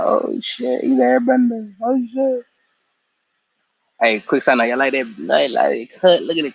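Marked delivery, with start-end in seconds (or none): 0.0-2.5 high squeaky "oh shit" of amazed onlookers; mock shocked shriek. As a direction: squeal it out like stunned villagers. Surprised onlookers see airbending.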